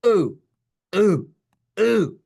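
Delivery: loud, high ooh as if hit in the tummy; mock ooh of pain. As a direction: cry out ooh like a ball hit the stomach. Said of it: "ball headed for the tummy".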